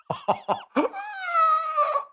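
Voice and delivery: a long squeaky burst of laughter; high-pitched laugh. Real, not acted. Host laughing in disbelief at the bill's terms, high squeaky giggle. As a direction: let a high giggle run away with you.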